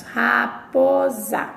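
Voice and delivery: raposa stretched very slowly; slow pronunciation demo. Shown as a demonstration. Teacher to class, modelling how raposa is pronounced. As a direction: draw out raposa syllable by syllable. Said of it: "o que nós pronunciamos".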